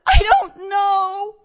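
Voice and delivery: high, loud, drawn-out cry; panicked child's wail. Retold or imitated. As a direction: wail it slowly like a scared child. Scared child answers mother in panic.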